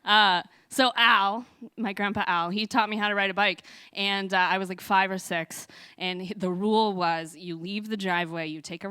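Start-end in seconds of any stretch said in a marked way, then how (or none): none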